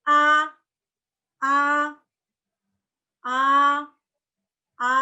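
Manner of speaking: "A" held high and perfectly even; high level tone. Shown as a demonstration. Teacher demonstrating tone one, high level tone. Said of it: "Jadi nada datar dan tinggi".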